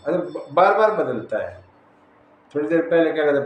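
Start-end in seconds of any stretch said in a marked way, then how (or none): none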